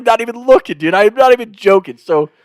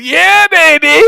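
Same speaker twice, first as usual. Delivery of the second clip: high, loud, stretched-out whoop; reenacted victory shout; celebratory yell; 10 semitones higher, 5 dB louder, much slower than usual. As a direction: holler it running down the field in triumph. Celebrating while running down the field.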